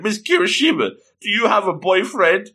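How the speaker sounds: high-pitched, pushy romantic question; overly forward. Retold or imitated. Hide grabs the waitress to ask her, overly forward. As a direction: pitch it up, boldly putting her on the spot. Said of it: "he goes a little bit too far".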